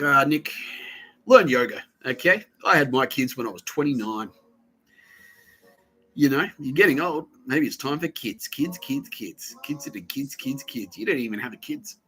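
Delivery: mock well-meaning pestering; lightly voiced nagging colleague. Colleague nagging teller at a work meeting, lightly mocked.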